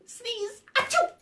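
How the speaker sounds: big hammed-up achoo, high; a playful pretend sneeze; mock sneeze. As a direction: fake a huge sneeze for the kids. Sneeze ending the song.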